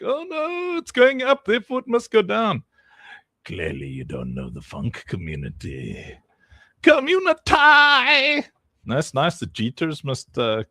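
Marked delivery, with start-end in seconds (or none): 0.0-2.6 much higher panicky squeal; mock panic. Streamer mocks panicking sellers in a high squeal. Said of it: "they think". 3.4-6.2 much lower, softer mock-serious taunt. Streamer taunts panicked sellers, low and smug. 6.8-8.5 much higher, louder, slow stretched yell; mock yell. Streamer hypes the funk community with a slow yell. Taunting sellers, drawn-out yell.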